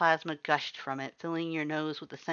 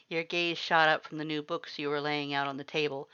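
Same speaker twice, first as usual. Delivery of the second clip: ordinary delivery, nothing marked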